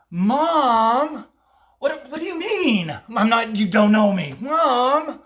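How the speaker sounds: put-on pleading child. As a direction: play a desperate little boy calling for his mom. Kid pretending she's his mom.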